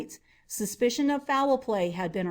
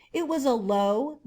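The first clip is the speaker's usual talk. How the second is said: ordinary delivery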